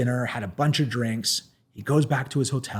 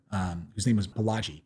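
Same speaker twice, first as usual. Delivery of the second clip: normal talking voice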